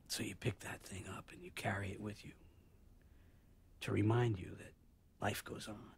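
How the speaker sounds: softly, weighing each phrase with long pauses; quiet, solemn reflection. Acted. Man tells Bob how regret is carried through life, quietly solemn. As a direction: let the voice sink into hushed regret.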